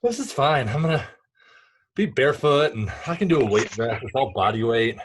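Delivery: breathless after a workout; out of breath. Real, not acted. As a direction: talk while still catching your breath. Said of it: "apologies if I'm catching my breath".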